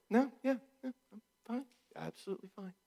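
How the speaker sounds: sulky sing-song denial, trailing to nothing; passive-aggressive fine. Acted. Partner sulking, insisting they're fine in high sulky voice. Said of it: "they're obviously angry".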